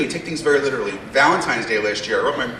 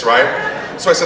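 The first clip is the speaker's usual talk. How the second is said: ordinary talk, nothing marked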